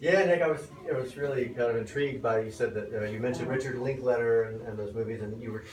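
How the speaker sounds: usual speaking voice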